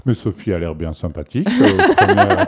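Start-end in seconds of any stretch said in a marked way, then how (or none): 1.5-2.5 chuckling through the words; laughing. Real, not acted. Technician teasing the host on air, laughing. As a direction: tease her while laughing.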